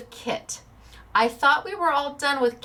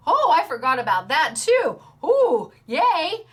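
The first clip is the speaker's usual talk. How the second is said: louder, rising into a cheerful yay; mock delighted viewer; 6 semitones higher, 5 dB louder than usual. Crafter voicing a thrilled viewer, mock delight. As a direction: play a thrilled viewer remembering.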